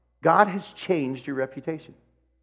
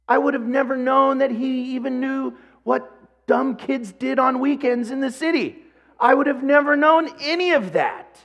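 rising preacher emphasis; 10 semitones higher than usual. Real, not acted.

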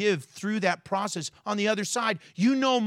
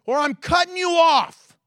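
high, loud final warning; God giving a final warning, loud and stern; stern ultimatum; 9 semitones higher, 6 dB louder than usual. Retold or imitated. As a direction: lay down the last chance, shouting. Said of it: "where God says".

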